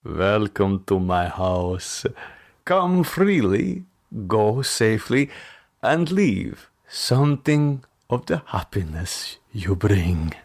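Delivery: put-on slow, foreign-sounding old count; strange intonation. The old man welcoming the clerk at his door, slow and strange. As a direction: draw out the welcome slowly like an eerie host. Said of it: "Again he said".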